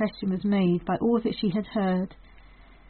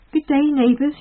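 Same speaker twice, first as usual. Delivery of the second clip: bright, louder, higher hello to neighbours; cheery greeting; 7 semitones higher, 7 dB louder than usual. A narrator's reading. Farmer's wife greeting neighbours, cheerily. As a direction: call a cheerful good day. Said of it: "she said cheerily".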